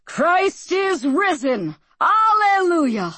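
high, slow, ringing proclamation; calling out. A narrator's reading. Pastor calling out to his congregation, high and slow. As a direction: lift it out to the whole church. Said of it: "and then he calls out".

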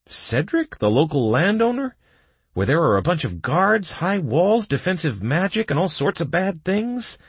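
high-pitched, incredulous questioning; astonished, climbing high voice; asked in surprise. A narrator's reading. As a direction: list the dangers in disbelief. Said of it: "Doc asked in surprise".

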